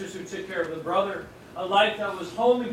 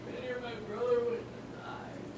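softer, higher quiet lament; mournful Mary; 5 semitones higher, 7 dB quieter than usual. Retold or imitated. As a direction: breathe it out like a grieving sister. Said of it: "that would have been Mary".

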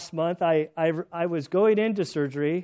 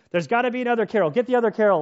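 loud, insistent, higher pitch; barking orders; 6 semitones higher, 6 dB louder, much faster than usual. Retold or imitated. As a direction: order them to find the other Carol. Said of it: "I'm barking orders".

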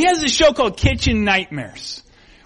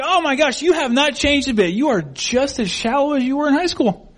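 higher-pitched mock amazement; backhanded compliment; 6 semitones higher than usual. Judging an old classmate at a reunion, disparaging. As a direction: exclaim it as a thinly veiled put-down. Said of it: "it really wasn't a compliment".

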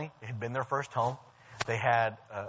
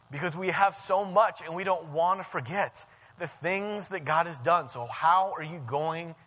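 raised, higher-pitched sermon voice; fervent preaching; 5 semitones higher, 4 dB louder than usual. Real, not acted. Preacher reminding the church of God's faithfulness, fervent preaching. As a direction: press it home to the congregation with rising fervour.

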